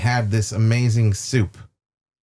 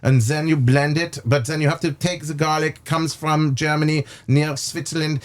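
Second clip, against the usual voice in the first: put-on light German accent, pitched a bit higher; lightly put-on German accent, chef rattling on; 4 semitones higher than usual. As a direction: imitate the chef's German accent while he explains. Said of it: "How do you like his, uh, little, uh, accent?".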